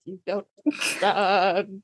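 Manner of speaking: slow, half-crying complaint; mock tearful whine. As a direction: play the heartbroken fan, slowly and tearfully. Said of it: "And I was like".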